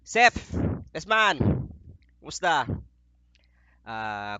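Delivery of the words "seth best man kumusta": loud, high call to his best man. Real, not acted. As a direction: shout his name across the room.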